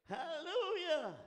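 high, loud, drawn-out cry of praise; praise shout. Real, not acted. Preacher praising before the congregation, high and loud. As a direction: let it ring out long and high.

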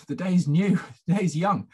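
a light reassuring chuckle through the words; gentle chuckle. Retold or imitated. Cheering up a sobbing boy.

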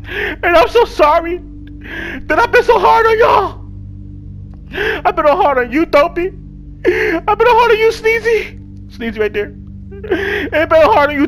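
high, slow fake-tearful apology; mock tearful apology to the game characters; mock crying. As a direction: ham up a sob while apologizing. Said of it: "I'm about to cry".

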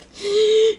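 sudden loud panicked gasp; sharp gasp. Retold or imitated. Anxious about getting ready.

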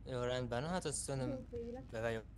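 usual speaking voice

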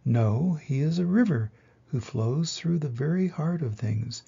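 ordinary delivery

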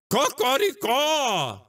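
slow shouted cock-a-doodle-doo; rooster crow. Acted. Crowing like a rooster. (canto de galo gritado e lento)

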